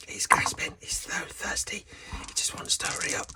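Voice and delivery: breathless whisper, voiced as the thirsty self. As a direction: whisper it like you're parched and gasping. Voicing thirsty alter ego.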